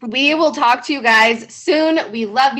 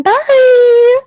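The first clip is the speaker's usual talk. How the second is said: loud, high, long-held goodbye; over-the-top aggressive farewell; aggressive sorority bye; 13 semitones higher, 11 dB louder than usual. Retold or imitated. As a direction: belt out a sorority-house bye. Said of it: "Oh, it's so aggressive".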